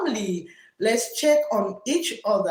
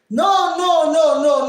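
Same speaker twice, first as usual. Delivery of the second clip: emphatic no; 5 semitones higher, 6 dB louder than usual. Retold or imitated. Reading out a viewer's comment.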